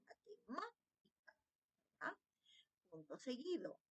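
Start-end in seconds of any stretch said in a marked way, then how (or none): 0.1-1.4 "matemática" with MÁ lifted high; "matemática" broken out to show the accented MÁ; stress on MÁ. Shown as a demonstration. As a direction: say "matemática" with the pitch jumping up on the third syllable, softer after. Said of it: "por lo tanto lleva tilde".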